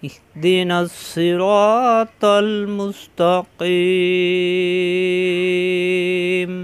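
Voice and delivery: slow melodic chanted recitation of al-Fatiha; Quran recitation. Shown as a demonstration. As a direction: chant the whole surah with long drawn vowels. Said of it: "আমরা আরেকবার পড়ি।".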